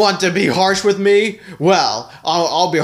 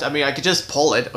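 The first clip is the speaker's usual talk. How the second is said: normal talking voice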